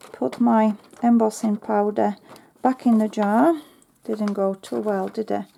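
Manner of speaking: usual speaking voice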